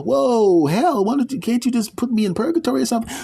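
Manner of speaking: very high, fast panicked voice. Acted. Man begging the gatekeeper for purgatory, panicked. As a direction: plead in a panic.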